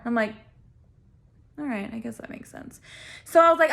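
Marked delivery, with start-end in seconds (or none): 1.6-2.8 very soft, doubtful concession; acted quiet, unconvinced agreement; grudging acceptance. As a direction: concede it under your voice, not buying it. Grudgingly accepting his excuse.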